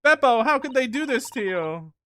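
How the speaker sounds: loud, high mock wail; mock outrage. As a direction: cry out in hammed-up dismay. Mock outcry over a character's plight.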